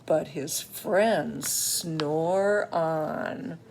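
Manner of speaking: drawn-out, slow read; slow it right down. Acted. Ending line, slowed for effect.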